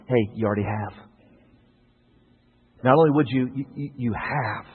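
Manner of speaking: God quietly pointing back at me; God's gentle rebuke. Acted. God answers the preacher.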